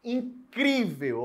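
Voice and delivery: "incrível" very slowly, "cri" high and stretched; stress on CRI. Shown as a demonstration. Teacher showing the tonic syllable, stressed "cri" in "incrível". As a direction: hold and lift "cri" in "incrível". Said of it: "O cri é a sílaba tônica".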